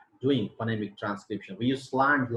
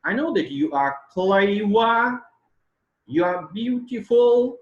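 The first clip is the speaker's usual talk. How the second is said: loud, high, rising long on "clever" and "beautiful"; satirical falling-rising tone; 10 semitones higher, 9 dB louder than usual. Shown as a demonstration. Teacher showing satire, loud exaggerated rise on "clever" and "beautiful". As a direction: sweep "clever" and "beautiful" up, ironically. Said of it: "And an irony and satire".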